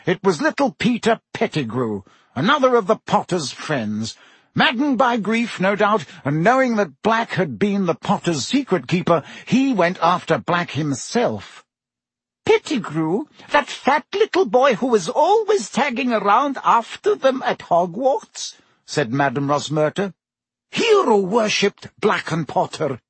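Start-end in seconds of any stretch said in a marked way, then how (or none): 0.0-11.6 lightly voiced pompous official; a hint of a fussy politician; Minister's voice. Fudge recounts the story. 12.5-18.5 much higher woman's voice, astonished; surprised barmaid. Acted. Rosmerta asks in disbelief.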